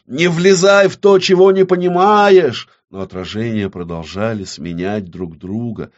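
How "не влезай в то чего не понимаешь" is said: high, loud angry warning; crying out. Acted. Авадонна warns the witch against meddling, shouting. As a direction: shout to make them back off. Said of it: "выкрикнула Авадонна".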